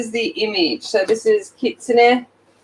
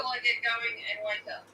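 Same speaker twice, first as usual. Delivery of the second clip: hushed, much softer; 15 dB quieter than usual. Shown as a demonstration. Vlogger demonstrating her sleepy quiet morning voice, hushed. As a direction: trail it off almost silently. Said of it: "it will be quiet".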